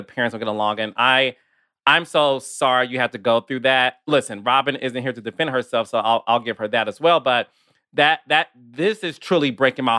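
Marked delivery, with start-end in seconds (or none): none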